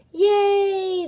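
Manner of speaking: high, loud, long cheer. A narrator's reading. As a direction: cheer in triumph as a crowd. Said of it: "the crowd cheered".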